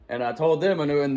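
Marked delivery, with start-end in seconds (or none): none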